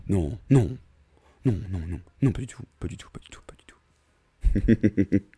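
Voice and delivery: hollow tube-like voice fading to a whisper; pipe voice. Real, not acted. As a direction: talk as if through a pipe. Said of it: "Ma voix de tuyau".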